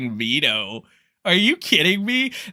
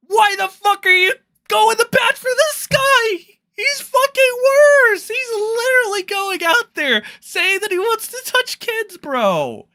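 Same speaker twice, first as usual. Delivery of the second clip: outraged yell; 4 dB louder than usual. Real, not acted. Ranting at Nick over pedo defense.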